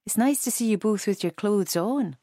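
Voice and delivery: put-on Edinburgh accent. A narrator's reading. Elderly lady teasing the walkers, Edinburgh accent. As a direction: tease them in an Edinburgh lilt. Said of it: "in an Edinburgh accent".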